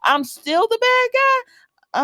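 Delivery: very high, slow, disbelieving; incredulous quote. Retold or imitated. Phyllis asks if she is still the bad guy, very high and slow. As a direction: stretch it out in disbelief. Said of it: "And she said".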